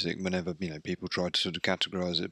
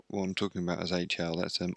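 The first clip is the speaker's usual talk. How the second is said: ordinary delivery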